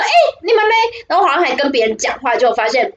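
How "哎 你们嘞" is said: calling out. Retold or imitated. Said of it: "就说".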